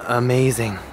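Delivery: ordinary talk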